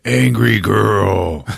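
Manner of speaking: very drawn-out greeting in brute voice; Hulk. Retold or imitated. Hulk greeting visiting Valkyrie, slow drawn-out call. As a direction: stretch each word slowly as Hulk. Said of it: "he's like".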